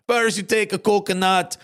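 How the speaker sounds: a mock Jamaican commercial voice, playful; Jamaican accent. Host retells the Jamaican ad, imitating the accent. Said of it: "It was a Jamaican gentleman, and he was like".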